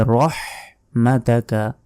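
rounded heavy r and strong h in রহমাতাকা; correct throaty h. Shown as a demonstration. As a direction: say রহমাতাকা carefully, breath from the throat on h. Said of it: "বড় হার উচ্চারণ করতে হবে".

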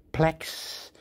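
ordinary talk, nothing marked

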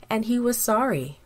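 usual speaking voice

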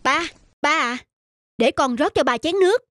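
giọng cao, ngọt xớt giả lả; nịnh nọt cha để được chia tiền; giọng con trai nịnh cha. Acted. Greedy son fawns on father. (người con nịnh cha, giọng cao ngọt xớt)

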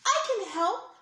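shouting extra loud. Acted. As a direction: call out over the crowd. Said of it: "Liam shouted extra loud".

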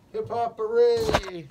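loud slow cheer. Real, not acted. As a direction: shout it out in triumph. Cheering a success.

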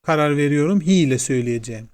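ordinary talk, nothing marked

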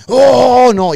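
a sudden high, loud wail; exaggerated belting. Shown as a demonstration. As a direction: overdo the vocal climb on purpose. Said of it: "la exageración de subir".

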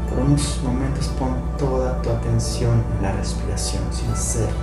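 calm guided meditation voice; right in your ear. Acted. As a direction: guide them gently into stillness.

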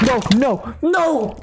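panicked high no. Real, not acted. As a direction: cry out in panic. Creeper about to explode.

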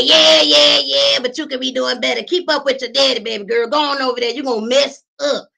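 put-on high, loud nagging old lady; scolding senior. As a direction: nag her like a busybody at the gym. Senior women scolding her.

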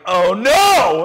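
high, loud mock panic; hammed-up oh no; mock dismay. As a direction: yelp it in playful alarm. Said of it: "now you're like".

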